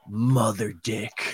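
slow, low mock-ominous line delivery; quoted TV line, whispered. Co-host quotes favourite bad TV line, slow and low. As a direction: drop low and draw out both words. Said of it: "whisper it to her all the time".

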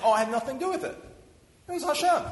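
high, loud mock voice of a rabbi dodging credit; mocking pious deflection. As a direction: lampoon the rabbi who hands it all to God. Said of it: "they always say".

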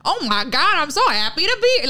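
high, loud, gushing mock-American tourist; loud American impression. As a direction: imitate an American with the volume turned up. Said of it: "turned up way louder".

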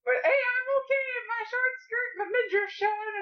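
hint of a chirpy put-on quote; mock carefree character. Wife mocks the underdressed actress in snow, lightly acted.

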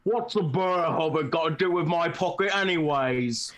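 puffy-cheeked troll, as if tennis balls fill the cheeks. Acted. Podcaster acting out the troll scene for friends, mouth-stuffed troll voice. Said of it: "acting like you have tennis balls in your cheeks".

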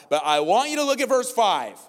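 high, loud, slow preaching; preaching at full force. Acted. Pastor driving home the verse to the church, full-force preaching. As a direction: thunder the verse out.

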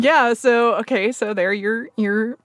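sheepish laugh breaking into the words. Real, not acted. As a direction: chuckle through the admission of being caught out. Caught out, laughs at herself.

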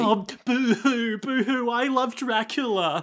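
mock boo-hoo in a high voice; hammed-up fake sobbing, slow; fake crying.

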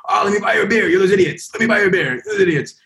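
buy them a beer while teasing; teasing old locals. Retold or imitated. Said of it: "they would be like".

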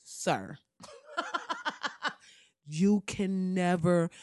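hushed, slow pleading staffer; lightly voiced aide begging him; Obama's aides pleading. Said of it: "they was like".

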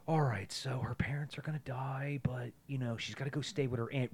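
whispering studio pitch; hushed, conspiratorial whisper; mock whisper. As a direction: whisper the cliché like a secret idea. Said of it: "and they're like".

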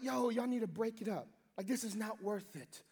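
low, soft reasoning voice; calm peacemaker. Pretend. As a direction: talk them down quietly. Imagined peacemaker stepping in.

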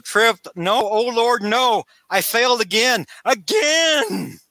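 high, hammed-up wail of failure; dramatic despair. Scientist drops the beaker, a high hammed-up lament. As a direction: ham up the lament, climbing on the last word. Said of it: "Oh, dramatic".

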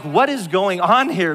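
retold exasperation. Retold exasperation at repeated breakdowns.

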